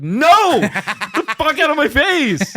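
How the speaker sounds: high, hammed-up outraged yell; shouted refusal. Retelling refusal to buy another cigarette, shouted outrage. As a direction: yell him away in comic outrage.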